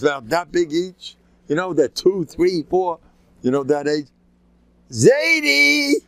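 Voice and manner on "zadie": put-on high-pitched, loud little-kid holler; kids yelling. Little kids calling their grandfather across the courtyard, yelling. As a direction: yell it out high like an excited small child. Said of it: "They're yelling at me".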